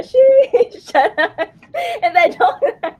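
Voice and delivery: high, loud squeal dissolving into giggles; teasing, laughing. Real, not acted.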